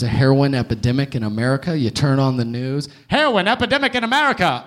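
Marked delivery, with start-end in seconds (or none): 3.1-4.6 put-on fast, higher old newsreel announcer; 1940s radiocaster. Comic parodying TV news as a forties radiocaster, brisk and bright. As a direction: rattle it off like a forties radio man. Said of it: "like a nineteen forties radiocaster".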